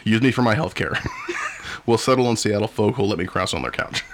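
ordinary talk, nothing marked

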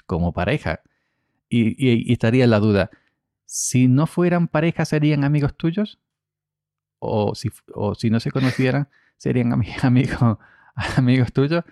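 ordinary talk

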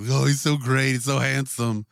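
loud gushing, imitating adoring fans; hammed-up fawning; mock gushing fans. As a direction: gush over a heartthrob, mockingly. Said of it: "everybody's been blowing Oscar Isaac".

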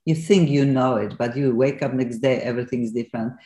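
normal talking voice

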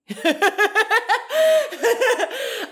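bright rising laugh; high giggle. Real, not acted. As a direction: laugh it off, amused. Laughing at her own joke.

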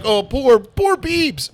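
high-pitched, exaggerated cooing sympathy; mock pity. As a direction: gush with put-on pity, pitch climbing high. Mocking pity for a rich celebrity.

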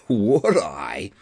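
low, far-off put-on young man's voice; a low, relishing retort; eager acceptance. A narrator's reading. Tug answers uncle's invitation to come along, eager.

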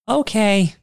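put-on high-pitched little-kid voice; mock childlike reply; little girl imitation. Voicing little girl's reply.

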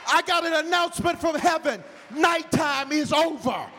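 high, loud, slow shouted preaching; loud, high, slow preaching to the whole church; full-force preaching. Acted. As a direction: preach it out to the back row.

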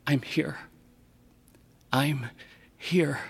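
soft hushed whisper with pauses. Acted. A child bowing to the shellfish, whispering. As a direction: breathe the words softly to the shellfish. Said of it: "bow and whisper to the shellfish".

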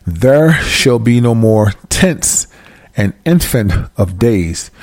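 very slow, spaced-out verse reading; slow it right down so they follow. Shown as a demonstration. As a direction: read the verse slowly so listeners grasp it. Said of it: "I'm gonna read it slow".

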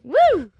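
high, loud startled "woo"; sudden high cry, imitating a startled driver; mock startled whoop. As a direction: jolt awake with a sudden whoop. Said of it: "Get startled driving".

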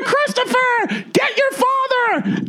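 very high, drawn-out mock scream; screaming mom. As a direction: imitate a frantic mother shrieking orders. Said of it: "I hear my mom screaming".

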